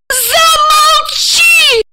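long, very high-pitched shriek; hysterical scream. A narrator's reading. Wife silencing husband, hysterical scream. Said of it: "истерично завопила во весь голос".

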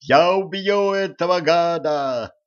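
high, drawn-out heroic threat; shouted threat. A narrator's reading. Елисей vows to kill Кощей, shouting. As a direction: call out a vow of vengeance.

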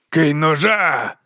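slow, drawn-out guard's yell; bellowed order. A narrator's reading. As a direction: bellow it as a command. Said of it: "заволав голова варти".

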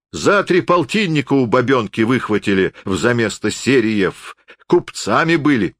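suspicious peasant forester. Acted. Forester argues about checking money. (недоверчивый деревенский лесник, высоким голосом)

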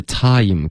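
time with a big open start, slowly; teacher models time; wide-open time. Shown as a demonstration. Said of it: "time开头嘴巴再大一点点".

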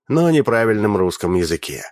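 ordinary delivery

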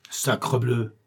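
a hint of a swearing old man; understated oath. A narrator's reading. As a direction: mutter the curse with a touch of anger. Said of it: "cria-t-il".

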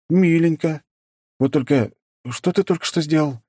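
high sarcastic jab; mocking. A narrator's reading. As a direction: tease the monster lightly. Hero mocks the monster.

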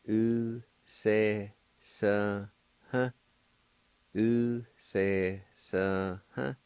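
"U se sa ha" very slow, syllables spaced; slow model pronunciation. Shown as a demonstration. Teacher modelling a word slowly for the class. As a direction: draw out "U se sa ha" for learners to copy. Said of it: "It's pronounced like this".

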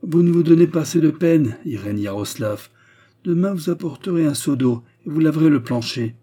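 high, loud bossy reprimand; scolding command. A narrator's reading. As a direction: order her about with contempt. Master scolding his forced servant.

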